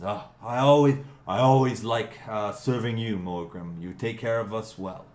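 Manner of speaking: butter up a favoured guest; fawning innkeeper. Acted. Innkeeper flatters his regular guest, fawning.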